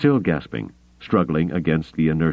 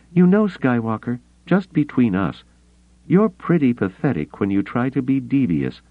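high, teasing Mara voice; teasing woman; 7 semitones higher than usual. Acted. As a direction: needle him with dry teasing.

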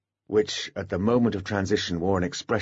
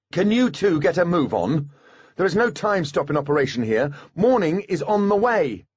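higher, brisk woman's prod; impatient prod; 8 semitones higher than usual. A narrator's reading. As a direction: hurry them along firmly. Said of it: "a prod at medium volume".

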